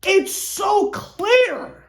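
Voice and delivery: high, slow, stretched-out mock voice; drawn-out mock voice. Fan mocking the Nets in a high slow put-on voice. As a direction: drag out every word in a put-on voice.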